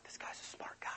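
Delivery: hushed aside between conspirators; mock whisper. Prophets whisper to each other about Elijah, hushed. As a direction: whisper it behind your hand.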